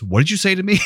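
imitated outraged challenge, pitched high; mock-offended, voice jumping way up; affronted teacher. Music teacher challenging a student onstage, imitated indignation.

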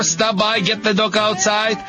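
ordinary delivery

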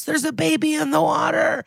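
high, imitated emergency call; mock scream. Cops calling EMS about baby in river, mock scream. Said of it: "We hear other cops scream to E M S".

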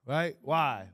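ordinary talk

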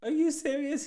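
high, loud incredulous question; mock disbelief. Girl to judge after thirty days' sentence, high mock disbelief. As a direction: imitate someone stunned, pitch shooting up.

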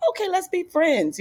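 chirpy friend offer. Retold or imitated. Comic imagining asking coworkers to be friends, high eager chirp. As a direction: pipe up like a shy new kid. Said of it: "how do you get in and say".

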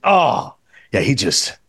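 dropping low and slow on he just glides; a hint of Pacino, savoring the words; imitating Pacino. Host retells Pacino praising Curry, low and slow. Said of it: "the way he said it".

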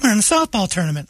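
high put-on falsetto; mock softball player. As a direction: chirp it as an innocent team member. Mimics softball family abroad.

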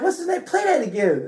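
high-pitched eager questioning; imitated excited request. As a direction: act out a newly hooked fan. Retelling excited request to replay song.

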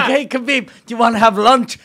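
high, loud mock invitation; friendly lunch invite. Someone casually asking a fighter to lunch, loud and friendly. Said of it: "You're like".

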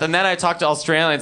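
ordinary talk, nothing marked

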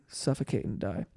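normal talking voice

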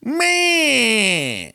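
whiny high meh; high, drawn-out cat cry. Retold or imitated. Host mimics the cat's yowl, high and long. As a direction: wail it like a yowling cat. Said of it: "and then he's like".

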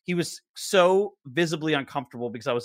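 ordinary talk, nothing marked

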